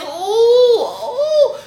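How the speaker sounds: high pained oohs; mock moan. Patient in pain in bed, high mock moans.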